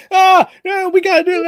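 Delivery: high, loud playful imitation; mock frantic agreement. Player imitating the animated character, mock frantic agreement.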